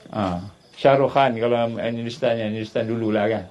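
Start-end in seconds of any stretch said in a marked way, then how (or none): none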